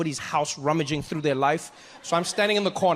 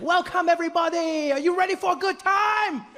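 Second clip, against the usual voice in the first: high-pitched, overjoyed tour guide; sky-high gleeful welcome; way too happy; 11 semitones higher than usual. Acted. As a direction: greet the group far too cheerfully. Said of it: "really happy, way too happy".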